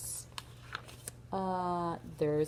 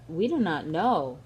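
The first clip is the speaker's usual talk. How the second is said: usual speaking voice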